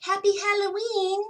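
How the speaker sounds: high, bright motherly voice; high doting mother voice. A narrator's reading. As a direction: gush like a proud mum. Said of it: "said Oscar's mother".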